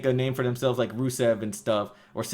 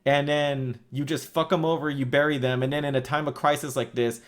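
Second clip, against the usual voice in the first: ordinary talk, nothing marked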